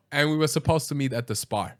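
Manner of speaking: normal talking voice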